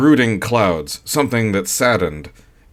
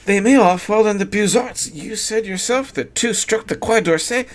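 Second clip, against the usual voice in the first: higher, quick, frightened sigh; the wife confesses her fear of the shells; young wife Sylvia; 12 semitones higher than usual. A narrator's reading. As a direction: shaken by the shells. Sylvia's voice held.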